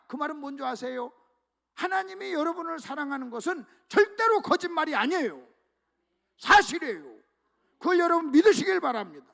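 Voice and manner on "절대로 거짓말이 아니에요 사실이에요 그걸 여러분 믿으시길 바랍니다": loud, fervent assurance; much louder fervent preaching; emphatic preaching. Real, not acted. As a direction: drive the truth home to the congregation.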